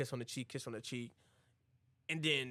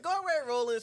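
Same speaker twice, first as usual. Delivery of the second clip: high, louder send-off; mock shouted dismissal; 13 semitones higher, 7 dB louder, faster than usual. Bride answers Roland at the door, high loud dismissal. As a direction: send him away through the door.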